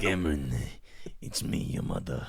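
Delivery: loud name, then hushed eerie mother; mock mother voice dropping to a near-whisper; mother impression. Mimicking own mother after friend's try, exaggerated hushed voice. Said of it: "done the impressions of my parents".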